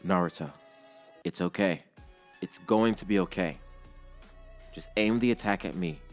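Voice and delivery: calm reassurance. Acted. Father calming his son.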